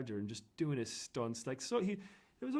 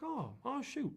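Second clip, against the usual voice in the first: high, soft, mock-startled; a hint of comic alarm; mock dismay; 11 semitones higher, 4 dB quieter, slower than usual. Roger told of the cracked pot, mild mock dismay. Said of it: "And he's like".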